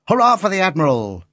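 put-on high, excited boy's hurrah; young lad cheering with gusto; child voice cheering. A narrator's reading. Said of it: "cried the boy".

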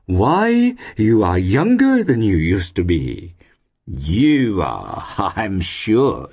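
high, swooping, drawn-out landlady voice; effusive landlady compliment; gushing flattery. A narrator's reading. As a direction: flatter him lavishly, voice swooping up. Landlady effusively flatters her guest.

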